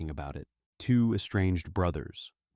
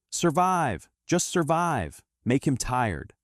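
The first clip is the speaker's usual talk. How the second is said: high, raised-voice yell of encouragement; louder, high-pitched shouted commands; urgent coach yell; 7 semitones higher, 4 dB louder, slower than usual. A narrator's reading. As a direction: yell it like a cornerman urging his fighter on. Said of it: "His coach Frank yells at him".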